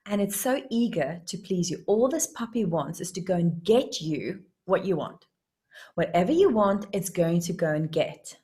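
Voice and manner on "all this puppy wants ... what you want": usual speaking voice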